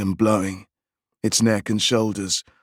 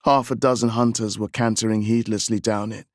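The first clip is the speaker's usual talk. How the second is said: usual speaking voice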